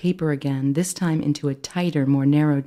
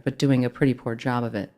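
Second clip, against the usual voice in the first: usual speaking voice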